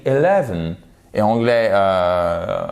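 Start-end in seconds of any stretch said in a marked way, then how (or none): 0.0-0.8 Eleven opening on a short E sound, British style; British eleven, first vowel like E; British English. Shown as a demonstration. Teacher contrasting British and American eleven for learners. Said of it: "Ça, c'est anglais britannique".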